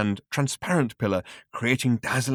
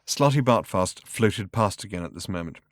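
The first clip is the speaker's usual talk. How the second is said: plain reading voice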